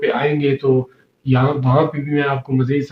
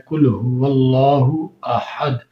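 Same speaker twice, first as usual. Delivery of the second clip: slow, measured tajweed chant of "قل هو اللہ احد"; قرآنی تلاوت کا انداز; Quran recitation; much slower than usual. Shown as a demonstration. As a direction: recite the verse slowly, each sound drawn out. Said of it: "اس ہا کو یہاں پر استعمال کیا گیا ہے".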